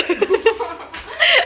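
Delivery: high burst of laughter; laughing burst. Real, not acted. Teasing sister after her punishment joke, high laughing burst. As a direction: crack up at your own tease.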